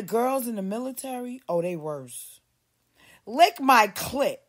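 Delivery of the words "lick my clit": slow, loud and drawn out; mock crude order. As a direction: drag it out like a crude command.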